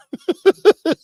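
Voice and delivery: shrill loud laugh; high burst of laughter. Real, not acted. Cracking up at the imagined threat, loud laugh.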